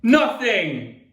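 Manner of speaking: loud, high one-word retort; snapped. A narrator's reading. Mother and sister to the boy, snapped. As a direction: snap it back to shut him up. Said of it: "they both snapped at the same time".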